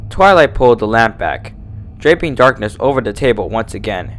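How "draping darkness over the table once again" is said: plain reading voice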